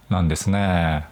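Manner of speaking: ordinary delivery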